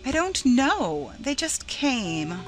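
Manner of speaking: slow, high, drawn-out plea; helpless distress. A narrator's reading. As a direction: wail it slowly, at a loss to explain. Laura helplessly explaining her lies.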